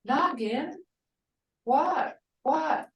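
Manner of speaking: high, rising "Noggin, noggin, what, what?"; grandma answering the kids with a lifted "what?". Retold or imitated. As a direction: ask "what?" going up each time. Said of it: "And I'll say".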